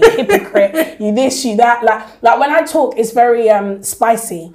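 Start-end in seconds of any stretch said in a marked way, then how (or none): 0.0-1.8 mock the husband, half laughing; laughing taunt at the husband; laughing mock insult. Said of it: "I'll be like".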